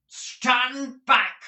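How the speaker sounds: high, loud cry to stand back; cried warning. Acted. Squeers brandishing his weapon, crying out loud. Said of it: "cried Squeers".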